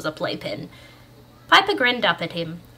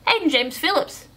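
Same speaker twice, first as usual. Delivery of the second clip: high, emphatic full name; indignant correction; 8 semitones higher, 4 dB louder than usual. A narrator's reading. Correcting his own name.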